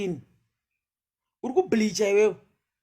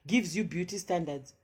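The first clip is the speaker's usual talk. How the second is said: ordinary talk, nothing marked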